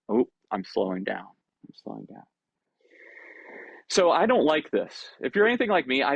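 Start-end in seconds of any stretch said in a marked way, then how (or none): 1.3-2.2 very soft, low trailing murmur; winding down. Acted. One of the herd calming after another feels with them, hushed and low. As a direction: drop right down in volume and pitch. Acting someone calming down, fading.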